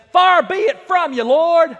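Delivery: loud, high indignant objection; indignant protest. Acted. Peter confronting Jesus to his face with a loud protest. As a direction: push back hard in his face. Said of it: "Peter gets in his face".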